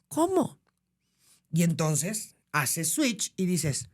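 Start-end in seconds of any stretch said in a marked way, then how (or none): 0.1-0.6 shocked question. Retold or imitated. Teacher shocked by protocol.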